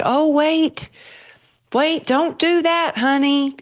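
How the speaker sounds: high, sweet coaxing nurse voice, slowing on don't do that; soothing nurse. Retold or imitated. Nurses coaxing the patient not to pull her tube, high sweet voice. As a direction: mimic the staff gently stopping her. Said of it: "And they were like".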